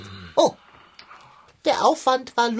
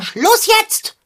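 loud, high furious stop; angry shout; 13 semitones higher, 7 dB louder than usual. Acted. Cat yells at the squabbling twins to stop now. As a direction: bark it to end the fight.

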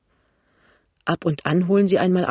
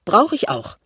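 mildly proud comeback, pitched higher; 5 semitones higher than usual. A narrator's reading. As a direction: assert it with a touch of pride. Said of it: "sagt sie stolz". (stolz auftrumpfend)

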